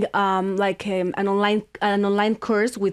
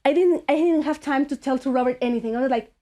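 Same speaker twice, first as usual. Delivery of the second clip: ordinary talk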